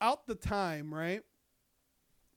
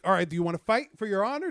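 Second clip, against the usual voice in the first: louder and faster, egging him on to fight; loud fast challenge; 3 semitones higher, 9 dB louder, faster than usual. Retold or imitated. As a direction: throw down the challenge at speed.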